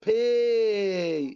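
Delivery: باي with "ay" held very long. Shown as a demonstration. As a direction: draw out the end of باي. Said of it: "أنا بمد في الإيه". (مدّ الإيه في «باي»)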